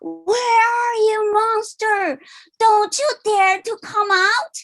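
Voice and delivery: high, mock-fierce monster yell; angry monster shout. A narrator's reading. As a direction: shout like a cranky storybook monster. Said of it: "The monster screamed as he ran".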